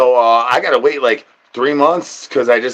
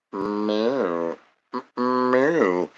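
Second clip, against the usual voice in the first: soft meow; 3 semitones lower, 8 dB quieter than usual. Acted. Imitating a cat.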